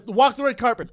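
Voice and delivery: high, loud call; urging shout. Retold or imitated. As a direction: egg someone on from the sidelines. Retold people urging him.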